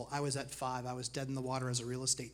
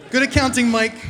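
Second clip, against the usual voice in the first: loud, high mock congratulation; sarcastic praise; 9 semitones higher, 17 dB louder than usual. Teasing his co-host with loud sarcastic praise. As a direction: tease her with fake applause.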